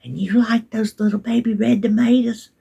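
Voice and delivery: put-on whispered, coaxing question to a little child; soft whisper to a toddler. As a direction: lean in and whisper it to the baby. Grandma asking toddler softly.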